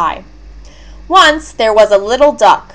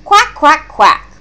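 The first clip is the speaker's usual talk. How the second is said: high quacks dropping on the last; spoken quacks with a final fall; mother duck's quacks; 5 semitones higher than usual. A narrator's reading. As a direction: quack back like a mother duck. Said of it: "said his mother".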